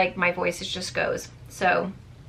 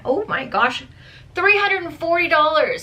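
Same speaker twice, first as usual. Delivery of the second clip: higher, louder gasp of disbelief at the number; shocked at price; 7 semitones higher, 3 dB louder than usual. Real, not acted. Vlogger checks the original perfume price, stunned and louder. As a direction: blurt it out stunned.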